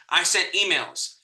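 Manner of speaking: normal talking voice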